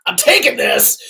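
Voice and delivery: a laughing, playful vow; laughing determination. Retold or imitated. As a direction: say it through a laugh like you've already won. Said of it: "what I did was".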